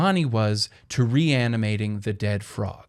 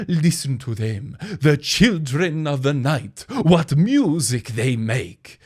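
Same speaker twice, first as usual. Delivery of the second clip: put-on slow, louder Transylvanian Dracula accent; drawn-out Eastern European count, savoring each word; theatrical Dracula accent, slowed way down; 2 semitones higher, 5 dB louder, slower than usual. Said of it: "I don't know why I gave him the accent".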